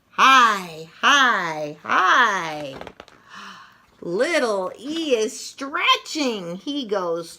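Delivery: lively picture-book read-aloud with punchy sound words; reading to children. Acted. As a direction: read it like story time for little kids, full of bounce.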